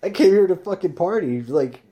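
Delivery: put-on yelling to old friends, surprised to see them; excited greeting. To bandmates met by chance, an excited shout. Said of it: "I was like".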